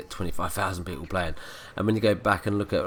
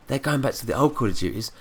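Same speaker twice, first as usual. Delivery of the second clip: heated rant; 7 semitones higher, 6 dB louder than usual. Real, not acted. Ranting about campers.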